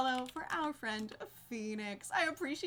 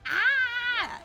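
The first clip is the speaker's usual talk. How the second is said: high loud mock scream; imitated scream; 11 semitones higher, 10 dB louder than usual. Explaining how she stuns people, a loud high shriek. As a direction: scream it like the banshee demon. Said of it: "by screaming".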